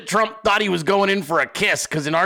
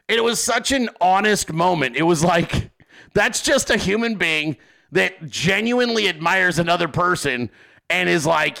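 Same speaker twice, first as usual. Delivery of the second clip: ordinary talk, nothing marked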